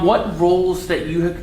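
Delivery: ordinary delivery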